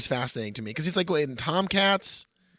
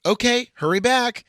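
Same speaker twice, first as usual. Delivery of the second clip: Cindy's line; 8 semitones higher, 5 dB louder, slower than usual. Acted. Cindy's line read aloud.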